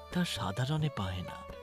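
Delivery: usual speaking voice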